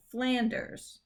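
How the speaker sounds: "Flanders" stressed FLAN-ders. Shown as a demonstration. Teacher repeating the right stress, FLAN-ders. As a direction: lean on the first syllable of Flanders. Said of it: "the stress is on that first syllable".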